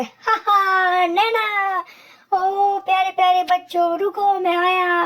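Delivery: ऊँची, खिंची हुई शरारती सेंटा की आवाज़ और हँसी; धीमे-धीमे हँसते हुए बच्चों को पुकारता Santa; Santa Claus. Acted. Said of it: "Santa क्लॉज ने नैना को देख लिया और वह". (बर्फ़ में बच्चों को पुकारता सेंटा, ऊँची बदली आवाज़)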